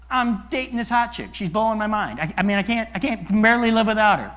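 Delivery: so smitten you can hardly finish; gushing lover. Retold or imitated. Young man raving about his girlfriend, excited.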